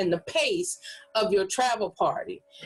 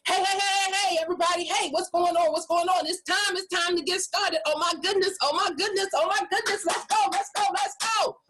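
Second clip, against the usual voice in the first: much higher, rapid, pumped-up host energy; hyped fast and loud; 8 semitones higher, faster than usual. Shown as a demonstration. Host bursts in to fire up the room, hyped. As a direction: burst in like a hype man opening the party. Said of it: "my picking up my speed and talking a little louder".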